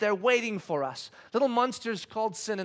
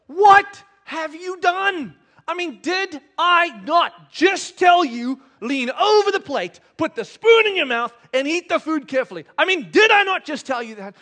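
acted furious parental scolding; scolding dad; 8 semitones higher, 10 dB louder than usual. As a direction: tear into the child, stressing each word. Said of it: "my brain starts shouting at me".

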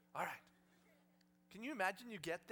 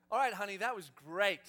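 louder, lightly acted fatherly praise; encouraging dad; 11 dB louder than usual. Father ending his daughter's first horse ride, mock hearty. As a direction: praise a child a bit too heartily.

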